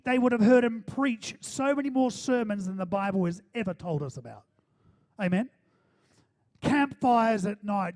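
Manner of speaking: loud, high, rolling preacher cadence; full-force preaching. Real, not acted. Preacher picturing campfire nights with Jesus, full-force preaching. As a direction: pile up the examples with mounting fervour, then ease down.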